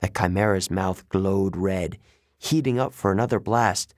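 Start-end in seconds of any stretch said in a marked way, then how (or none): none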